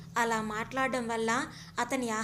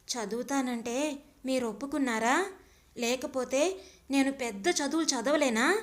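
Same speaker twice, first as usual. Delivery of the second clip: plain reading voice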